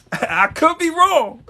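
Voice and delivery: high, slow words through laughter; chuckling mock modesty; laughing. Real, not acted. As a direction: laugh it off as you concede. Laughing at his own claim.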